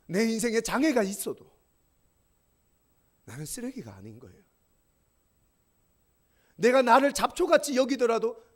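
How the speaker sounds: loud, high, fervent pulpit preaching; full-voiced sermon climax; preaching at full force. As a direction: drive each line home to the congregation.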